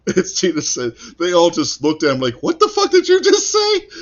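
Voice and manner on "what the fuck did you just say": high, loud, incredulous retort; mock shocked outburst. Drinking buddies to Tajiri on his sudden English, mock shock.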